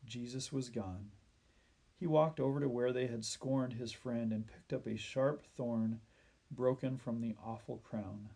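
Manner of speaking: plain reading voice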